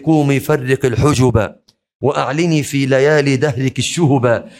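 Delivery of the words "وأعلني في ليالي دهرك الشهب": ordinary talk, nothing marked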